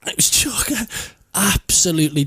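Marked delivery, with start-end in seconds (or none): 0.1-1.1 whispered. Real, not acted. Whispered emphasis.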